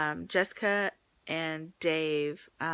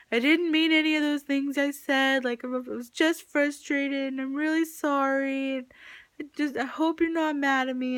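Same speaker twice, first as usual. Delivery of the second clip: higher, louder pleading apology; uncle's apologetic email; 8 semitones higher, 4 dB louder than usual. Retold or imitated. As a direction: read his sorry note as him, earnestly. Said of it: "he's like".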